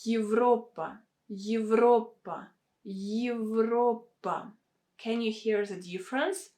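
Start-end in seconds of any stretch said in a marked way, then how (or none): 0.1-4.5 Европа as yiv-RO-pa, slowly; Y before reduced vowel. Shown as a demonstration. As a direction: model Европа with Y plus a short И at the start. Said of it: "we need to add this sound Y".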